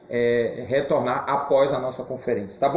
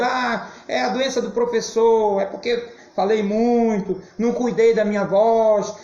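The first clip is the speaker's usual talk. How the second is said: queixa em tom mais agudo e arrastado; reclamação imitada; 6 semitones higher than usual. Retold or imitated. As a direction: resmungue desculpas em voz alta, como num lamento encenado. (reclamando, em tom de lamúria)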